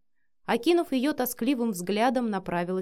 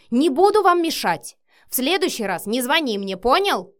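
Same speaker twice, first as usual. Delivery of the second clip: loud, raised parting shout; yelling; 4 semitones higher, 8 dB louder than usual. A narrator's reading. Marina yells at Matvey while leaving, shouting. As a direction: holler it angrily from the doorway. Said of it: "проорала уже в дверях Марина".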